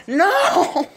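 very high no breaking into laughter; anguished scream. Real, not acted. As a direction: shriek in laughing despair. Game lost, scream.

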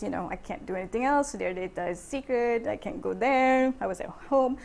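ordinary delivery

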